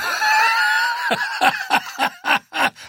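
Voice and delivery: a bellowing burst of laughter; roaring laughter. A narrator's reading. Ente roars with laughter at the dwarf's joke. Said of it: "brüllte vor Lachen".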